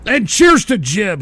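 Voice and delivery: ordinary talk, nothing marked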